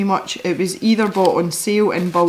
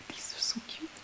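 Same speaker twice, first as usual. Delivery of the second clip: very soft whisper of delight; hushed cooing; 9 semitones higher, 20 dB quieter than usual. Real, not acted. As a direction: coo it almost without voice. Cooing over tiny paint pans.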